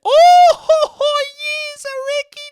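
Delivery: very high, squealing British caricature; loud cackle into a hammed-up squeal; Ricky Gervais. Comic showing what a celebrity sounds like, squealing cackly impression. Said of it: "but I know what he sounds like".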